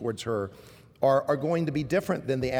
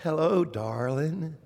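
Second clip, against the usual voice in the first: mild mother voice; slower than usual. Retold or imitated. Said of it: "she'll always say".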